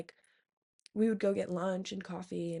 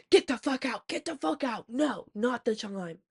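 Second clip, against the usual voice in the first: imitating an outburst, higher and sharply insistent; mock yell, pitched up, easing on the last words; retold angry yell; 3 semitones higher, 4 dB louder than usual. Friend ordering an interrupting roommate off the porch, retold angry yell.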